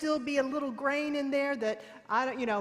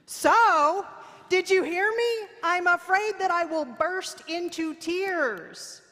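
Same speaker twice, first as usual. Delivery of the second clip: loud, high, hammed-up exasperation; mock exasperated protest; 8 semitones higher, 7 dB louder than usual. Coached woman protesting to her unbothered mentor, mock exasperated. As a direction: push it loud and high, stretching the last words.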